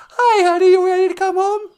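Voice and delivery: chirpy robot sidekick offering a ride home; high, squeaky sidekick voice. Pretend. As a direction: squeak it like a tiny robot pal. Voicing a spaceship companion.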